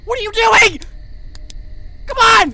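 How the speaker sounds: high, loud frustrated yell; exasperated shout. Real, not acted. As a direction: shout at the game in alarm. Yelling at the game.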